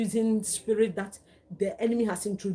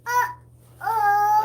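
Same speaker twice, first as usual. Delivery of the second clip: high playful uh-oh; 11 semitones higher, 7 dB louder than usual. Real, not acted. Playful baby talk, high squeal.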